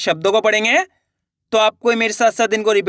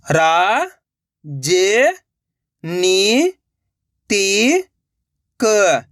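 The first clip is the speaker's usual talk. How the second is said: syllable by syllable; much slower than usual. Shown as a demonstration. Teacher sounding out a word for learners, syllable by syllable. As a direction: separate drawn-out syllables with long gaps. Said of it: "एक एक अक्षर का उच्चारण करके".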